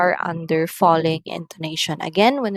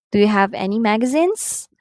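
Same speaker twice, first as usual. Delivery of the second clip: quick question climbing on "magazines"; rising intonation; 2 semitones higher, faster than usual. Shown as a demonstration. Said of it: "are going up".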